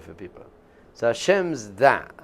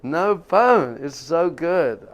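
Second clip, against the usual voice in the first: elated; 4 semitones higher, 5 dB louder, slower than usual. Retold or imitated. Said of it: "I'm like".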